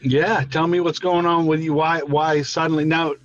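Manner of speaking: ordinary delivery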